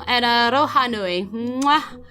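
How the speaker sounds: ordinary talk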